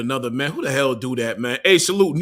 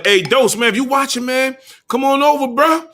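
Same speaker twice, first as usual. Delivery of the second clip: high, loud call to a rival; higher, louder call to come on the stream; 9 semitones higher, 3 dB louder than usual. Real, not acted. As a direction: holler him over, taunting.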